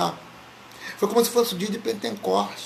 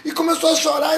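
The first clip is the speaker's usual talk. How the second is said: ordinary talk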